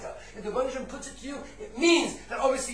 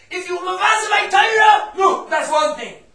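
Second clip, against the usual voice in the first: high, loud acted reply; emphatic retort; 9 semitones higher, 12 dB louder than usual. Student answering the driver who begged forgiveness, loud emphatic retort. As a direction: raise the voice sharply and stretch the last words.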